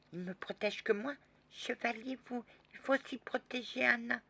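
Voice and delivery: put-on high child voice, demanding; a little girl scolding the knight; indignant little girl. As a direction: protest like a jealous small girl.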